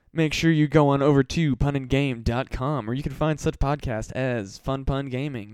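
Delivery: loud, bright announcer plug for the network; loud promo read. Acted. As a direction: sell it like a radio ad, loud and punchy.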